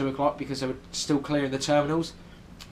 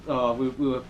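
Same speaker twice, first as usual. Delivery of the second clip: ordinary delivery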